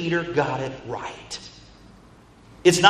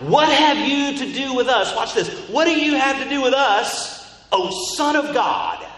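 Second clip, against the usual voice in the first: high-pitched loud outcry; crying out; 7 semitones higher, 3 dB louder than usual. Retold or imitated. Demons confronting the Son of God, crying out. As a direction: cry it out high and drawn. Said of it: "They cried out".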